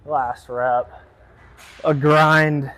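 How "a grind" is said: louder, forced through effort; strained under weight. Real, not acted. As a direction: squeeze the words out mid-lift. Straining through the last rep.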